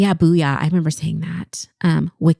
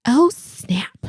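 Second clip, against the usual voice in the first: whispered catchphrase; 6 semitones higher than usual. Pretend.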